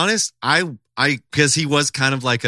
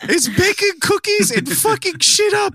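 very high, slow mock tough-guy line; high cracking teen doing a tough line; squeaky teen voice. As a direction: squeak out a movie threat like a teenager. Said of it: "that voice is, of course, [name] at fifteen".

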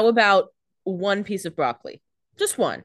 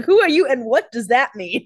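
higher and louder, affronted; mock indignant pizza girl; 5 semitones higher, 6 dB louder than usual. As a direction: play the offended delivery girl. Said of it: "She's like".

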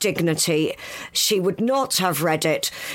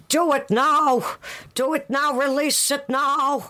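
put-on Meghan's American accent; 6 semitones higher than usual. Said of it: "chanting behind the scenes in her American accent".

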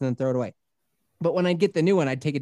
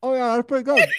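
higher, unconvincing polite approval; faking enjoyment; 7 semitones higher than usual. Retold or imitated. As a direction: pretend to like something awful. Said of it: "trying my best to act like I like it".

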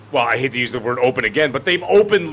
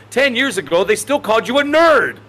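riled up, higher and louder; excited; 5 semitones higher, 3 dB louder than usual. Real, not acted. Gamer riled up about being called a nerd, excited. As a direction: pitch jumps high and louder on nerd.

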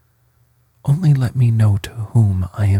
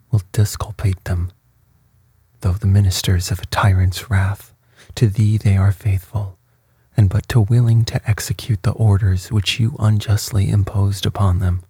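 usual speaking voice

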